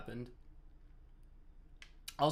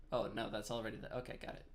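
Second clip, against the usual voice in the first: usual speaking voice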